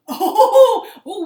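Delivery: high, very loud "ooh"; thrilled, laughing exclamation; excited whoop. Real, not acted. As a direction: whoop with delight after the launch. Excited whoop after launch.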